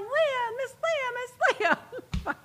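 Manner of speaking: high-pitched calling out from a car, imitating; mock yelling name. Driver calling to Leah from the passing car, mock yell. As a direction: call her name across the street, playful. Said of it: "they're just honking and honking and yelling".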